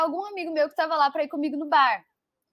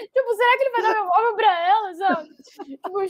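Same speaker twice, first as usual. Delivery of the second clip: laughing while telling; 6 semitones higher, 4 dB louder than usual. Real, not acted. Retelling crying, laughing now.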